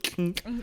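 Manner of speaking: high, light tink sound; mock sword clang. Sir Slicer blocking the swing, a light high tink. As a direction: imitate the blade ringing off armor. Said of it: "He's just like".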